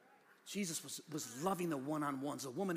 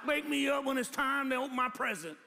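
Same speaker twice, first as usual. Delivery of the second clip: higher, louder mock sleeper's demand; acted-out quote; 8 semitones higher, 6 dB louder than usual. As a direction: call it out as the person you're imitating. Acting out someone sleeping in, talking.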